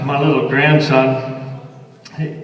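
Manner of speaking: ordinary talk, nothing marked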